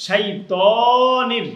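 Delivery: loud, high, long-held "too" in শাইতানির; slow recited শাইতানির with an elongated vowel; stretched vowel. Shown as a demonstration. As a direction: draw out the middle of শাইতানির. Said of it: "এখানে একালিফ টান হবে".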